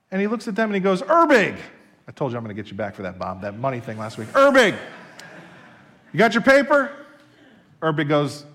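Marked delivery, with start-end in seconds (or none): 1.0-1.6 loud, high call of a student's name. Retold or imitated. Professor calling out a student, loud and high. 4.3-6.9 loud, high demand; stern demand. Retold or imitated. Professor demanding a student's paper, loud and high.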